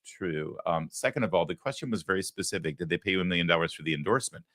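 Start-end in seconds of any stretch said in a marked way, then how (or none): 0.1-0.6 imitating a quiet, clipped British "Not true"; softly mocked British "Not true"; British accent. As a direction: mimic her British denial, softer. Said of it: "she has a British accent when she says".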